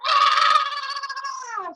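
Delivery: high, loud imitated child scream; child's scream. Son on the elevator floor, imitated terrified scream. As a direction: shriek like a terrified little boy. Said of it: "Screaming at the top of his lungs".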